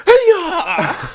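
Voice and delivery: high, loud mock kick cry; over-the-top yell. Imitating the film villain for a co-host, over-the-top yell. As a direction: yelp a drawn-out "hee-yah". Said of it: "such an over the top".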